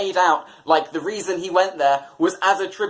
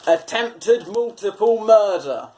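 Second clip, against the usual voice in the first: dramatic headline read; 5 semitones higher, slower than usual. Acted. Reading a dramatic headline aloud.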